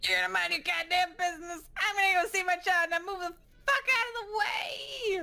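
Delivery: high-pitched, fast angry yelling; angry confrontation. Acted. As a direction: push past with a rising, furious shout. Jane confronts the nurse as told.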